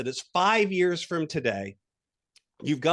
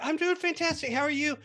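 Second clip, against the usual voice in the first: excited reply; 11 semitones higher than usual. Retold or imitated. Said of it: "You're like".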